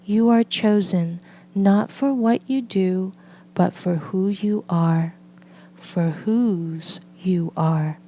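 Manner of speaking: ordinary delivery, nothing marked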